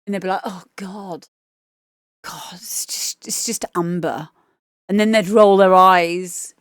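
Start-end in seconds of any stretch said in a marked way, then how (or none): 0.4-4.3 soft, breathless disappointed whisper; unimpressed classmates. Retold or imitated. Classmates realising it was only Amber, not the teacher, deflated whisper.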